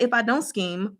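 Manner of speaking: ordinary talk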